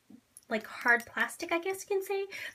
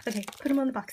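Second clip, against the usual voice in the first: ordinary talk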